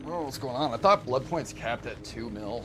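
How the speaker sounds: sudden amazed outburst; surprised. Real, not acted. Surprised at blood points.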